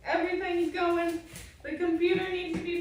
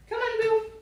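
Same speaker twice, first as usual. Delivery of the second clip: high dog command; 8 semitones higher than usual. Real, not acted.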